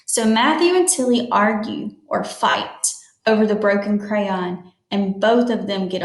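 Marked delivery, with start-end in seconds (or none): none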